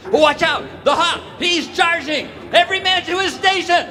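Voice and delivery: rally every man to his post; alarm shouts. Acted. Lieutenant ordering soldiers to fire at the charging Hawk. Lieutenant alerts fort under attack.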